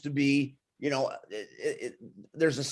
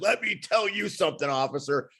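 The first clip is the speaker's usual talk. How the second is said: Hogan impression; 5 semitones higher than usual. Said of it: "driving Hogan around, he said".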